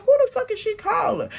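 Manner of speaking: high quick baffled aside; high incredulous whisper-thought. Retold or imitated. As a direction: ask it high and quick in disbelief. Retold own thought, high.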